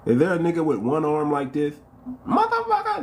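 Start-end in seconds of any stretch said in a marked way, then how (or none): 2.3-3.0 high squeal of disbelief; startled high-pitched cry; shocked exclamation. Real, not acted. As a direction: yelp it out, way up high. Exclaiming at one-armed troll.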